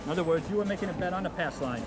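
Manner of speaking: ordinary talk, nothing marked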